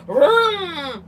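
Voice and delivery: long, drawn-out imitation of an engine revving; motorcycle vroom. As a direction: mimic a loud motorcycle roaring by. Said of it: "A very loud motorcycle".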